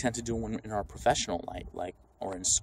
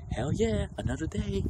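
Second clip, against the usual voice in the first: high, bright mock enthusiasm; mock cheerful; 10 semitones higher than usual. Imagining happy people on the street, high mock cheer. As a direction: play an overjoyed commuter. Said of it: "Are they like".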